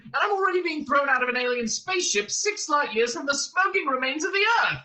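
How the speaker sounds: high, agitated rant; Arthur complaining. A narrator's reading. Man whose planet was demolished, complaining hysterically. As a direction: rant on with mounting outrage, pitch climbing. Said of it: "complained Arthur".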